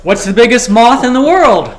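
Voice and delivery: high, loud riddle delivery; joke setup. Acted. Quizzing the room with a riddle, punchy setup. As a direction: throw the riddle out to the crowd.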